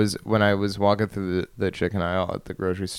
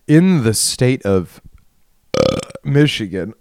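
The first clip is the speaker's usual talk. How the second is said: mock grandiose; 4 semitones higher, 6 dB louder than usual. Mock grand slow delivery.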